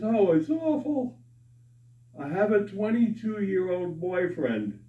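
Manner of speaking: put-on high-pitched wail of an old lady; mock tearful. Crying old lady on park bench answers passers-by, mock tearful. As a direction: lament it high, playing the crying woman. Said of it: "and she's crying".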